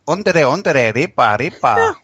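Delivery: hammed-up fake Brazilian with stretched syllables; mock Brazilian. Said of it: "she starts speaking in Brazilian, and Jiro's like".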